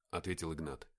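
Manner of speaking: plain reading voice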